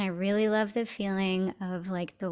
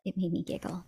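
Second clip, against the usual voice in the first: whisper; 2 semitones lower, 8 dB quieter, faster than usual. Real, not acted. Soft aside after laughing.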